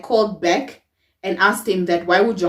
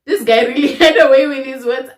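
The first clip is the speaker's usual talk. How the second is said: laughing; 5 semitones higher, 6 dB louder than usual. Real, not acted. Laughing at own naivety.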